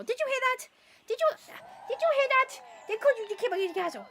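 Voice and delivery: high, cutesy voice to a pet. Real, not acted. Streamer tells pet what they called it, high baby talk.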